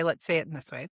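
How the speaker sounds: ordinary talk, nothing marked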